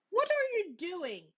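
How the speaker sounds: high, curious question; curious little sister. Acted. Sister asking Brother about the peas, curious. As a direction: ask it wide-eyed.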